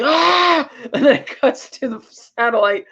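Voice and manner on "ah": high-pitched imitated scream; mock scream. Retelling Boris freaking out, mock scream. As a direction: yelp out a villain's scream, half in fun.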